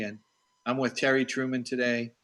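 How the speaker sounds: ordinary talk, nothing marked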